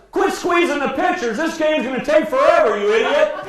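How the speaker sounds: high, full-force heckling rant; Split yelling. Retold or imitated. Broadcaster berating the umpire over the strike zone, yelling. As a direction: berate the umpire at the top of your voice. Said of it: "he starts yelling".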